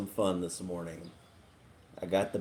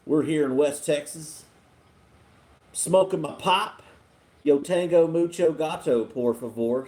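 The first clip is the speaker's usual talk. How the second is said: put-on Texan accent on request; 4 semitones higher, 5 dB louder than usual. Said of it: "Turn on your accent".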